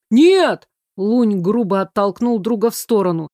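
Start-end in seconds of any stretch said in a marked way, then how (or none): 0.1-0.7 loud sudden high "no"; sharp shout. Acted. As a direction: stop him with a shout. Lun shoves friend away.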